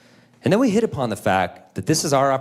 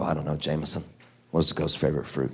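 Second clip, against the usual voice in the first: low, soft and unenthused; resigned adult reply; 8 semitones lower, 6 dB quieter, faster than usual. Retold or imitated. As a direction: humour the kid, quiet and low. Dad's resigned answer to the riddle.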